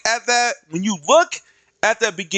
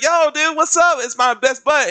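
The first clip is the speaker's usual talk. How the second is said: higher, bubbly buddy voice; mock happy greeting; 7 semitones higher than usual. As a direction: greet an old friend with delight. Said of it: "He's like".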